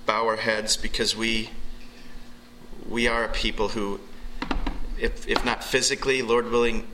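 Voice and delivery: low, earnest praying voice; pastoral prayer. Acted. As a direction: pray over the room, unhurried. Leads congregation in prayer.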